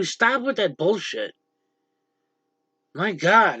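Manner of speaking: usual speaking voice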